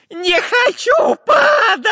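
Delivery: high, slow, loud wail; Dyrka in panic. A narrator's reading. Spy dashes along the branch, panicked wail. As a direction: scream and stretch the last word. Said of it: "заметался по ветке шпион Дырка".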